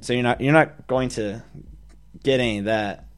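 usual speaking voice

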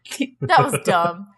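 ordinary talk

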